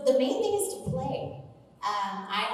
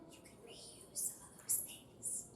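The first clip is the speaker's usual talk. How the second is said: hushed conspiratorial whisper; mock-secret whisper; 4 semitones lower, 15 dB quieter, slower than usual. Voice actor slipping students a sneaky demo tip, whisper.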